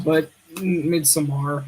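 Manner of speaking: ordinary talk, nothing marked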